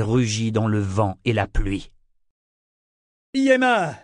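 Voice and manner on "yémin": high, desperate yell falling at the end; howled name. A narrator's reading. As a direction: scream out for a lost boy. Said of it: "répéta Kénit en hurlant". (nom hurlé d'une voix aiguë)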